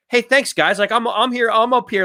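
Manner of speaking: jokingly fish for attention; joking around. Retold or imitated. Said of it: "he's joking around with them".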